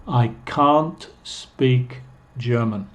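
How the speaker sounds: slow, long stressed ah in "can't"; emphasised vowel. Shown as a demonstration. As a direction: stretch "can't" into kahnt, words spaced out. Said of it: "pongo mucho énfasis en el vocal".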